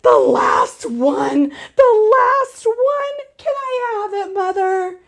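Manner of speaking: put-on high, pleading little-girl whine; child begging parents for the last swim ring; urgent begging child. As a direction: beg your parents, over and over. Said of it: "very urgent".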